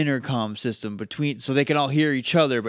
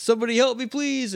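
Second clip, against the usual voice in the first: mock cry for help; 9 semitones higher than usual. Man hanging off a cliff calling out, high mock plea. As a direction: wail it like a man in trouble. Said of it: "and it's".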